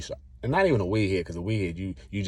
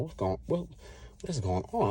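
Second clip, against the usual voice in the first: mild retold panic; 5 semitones higher, 7 dB quieter, slower than usual. Confused at sudden chest pain.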